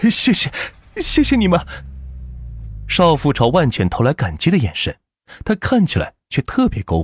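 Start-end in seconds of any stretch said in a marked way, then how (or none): 0.1-1.6 very high, emotional gratitude; tearful thanks. Acted. Young mother thanking the men who help her, tearful. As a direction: thank them as if near tears.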